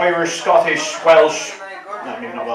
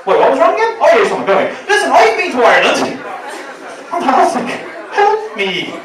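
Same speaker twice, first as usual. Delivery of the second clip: distracted doctor; 8 semitones higher, 3 dB louder than usual. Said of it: "doctor distracted, patient begging".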